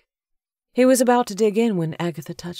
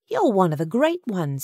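higher motherly voice, stated with conviction; earnest Agatha; 4 semitones higher than usual. A narrator's reading. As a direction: tell him firmly, as a sure fact. Agatha earnestly encourages Sutton.

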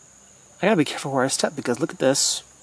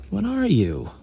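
ordinary talk, nothing marked

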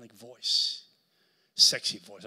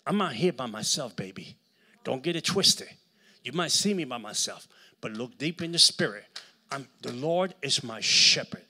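loud, punchy preaching at the devil; bold, forceful warning; defiant declaration; 3 semitones higher, 9 dB louder than usual. Acted. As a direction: stand your ground and let the enemy know.

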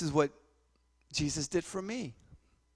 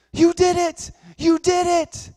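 much higher, loud joyful yell; ecstatic shout; 13 dB louder, slower than usual. Retold or imitated. Healed mother shouting at the boy, ecstatic shout. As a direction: scream it in sudden healed joy.

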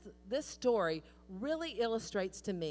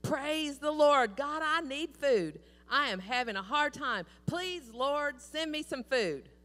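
high, loud pleading prayer, slow; fervent prayer; 6 semitones higher, 6 dB louder, slower than usual. Retold or imitated. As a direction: beg the Lord out loud from the porch. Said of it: "she prays".

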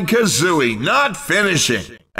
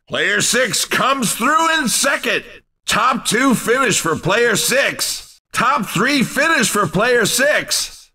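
ordinary talk

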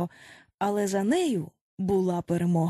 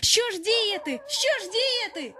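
alarmed wailing; 10 semitones higher than usual. A narrator's reading. Frightened boys facing wolves.

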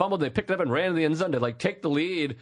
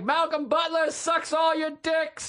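slow high shouted taunt; high slow heckling yell; 11 semitones higher than usual. Retold or imitated. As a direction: heckle the player at full voice. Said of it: "And I'm like".

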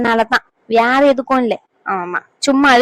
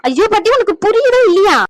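exasperated plea; 9 semitones higher, 4 dB louder than usual. Acted. Granddaughter pleads exasperated with grandma.

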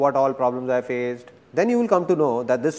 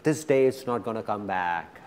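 quiet repeat; 6 semitones lower, 7 dB quieter than usual. Shown as a demonstration. Speaker to audience, hushed and lower. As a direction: lower and softer, letting it sink in. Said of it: "repeats softer".

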